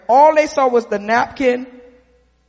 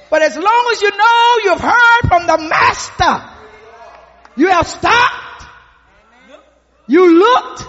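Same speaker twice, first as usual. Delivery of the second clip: rising, louder preacher's cadence; preaching at full force; 6 semitones higher, 5 dB louder than usual. Acted. Preacher to the congregation, rising loud cadence. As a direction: build it up from the pulpit.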